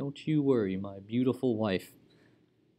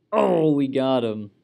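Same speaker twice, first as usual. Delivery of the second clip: triumphant; 3 semitones higher, 8 dB louder than usual. Real, not acted. Plot succeeds in game.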